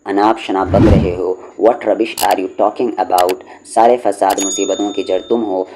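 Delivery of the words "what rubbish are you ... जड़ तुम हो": ordinary talk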